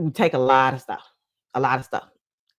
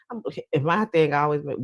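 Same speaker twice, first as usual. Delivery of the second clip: usual speaking voice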